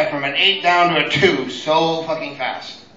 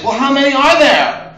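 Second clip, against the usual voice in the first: clueless mother-in-law; 7 semitones higher, 5 dB louder than usual. Retold or imitated.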